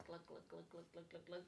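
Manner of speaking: low, soft glug glug; soft gurgling water noise; mock glugging. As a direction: make the pouring sound. Pretend pouring water.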